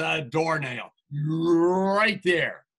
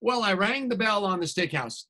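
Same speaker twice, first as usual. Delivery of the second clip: plain reading voice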